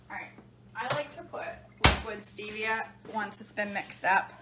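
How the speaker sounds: ordinary talk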